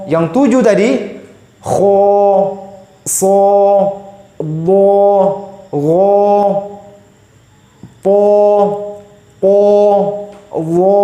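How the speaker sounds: "so", "do", "wo" said round and heavy; teacher modelling heavy rounded letters; thick letters. Shown as a demonstration. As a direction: round the mouth and make each letter thick. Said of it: "Semuanya tebal seperti itu".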